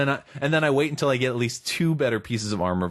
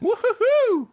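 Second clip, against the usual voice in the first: very high hillbilly whoop; old prospector; 5 dB louder, much slower than usual. Pretend. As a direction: whoop like a giddy old miner.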